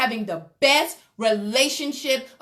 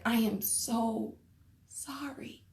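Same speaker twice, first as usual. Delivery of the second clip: fading to a soft whisper on sorry; whispered apology; 12 dB quieter than usual. Retold or imitated. Husband whispering his apology to his wife, hushed. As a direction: let sorry die into a breathy hush.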